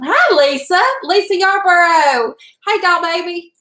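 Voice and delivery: bright, loud and high hello to a friend; excited greeting. Real, not acted. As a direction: call out her name happily. Greeting friend arriving in chat.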